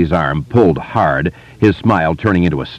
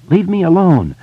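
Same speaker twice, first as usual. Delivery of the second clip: scared high plea; trembling voice; 11 semitones higher than usual. Acted. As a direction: beg him, voice shaking. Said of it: "Eddie said in a trembling voice".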